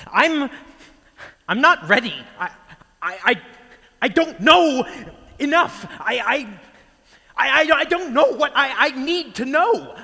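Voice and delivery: very high, loud stammering cry; panicked anguish. Acted. Disciple left behind without his teacher, shouting in panicked anguish. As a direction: shout it desperately, tripping over the first words.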